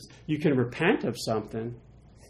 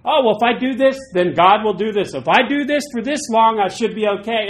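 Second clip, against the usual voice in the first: much higher, loud, self-assured; a smug bargainer; mock naive believer; 8 semitones higher, 7 dB louder than usual. Believers bargaining with God, higher smug mock. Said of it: "we always think".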